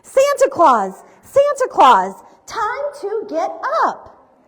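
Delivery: loud, high sing-song wake-up call; calling to wake someone. A narrator's reading. Mrs Claus wakes sleeping Santa.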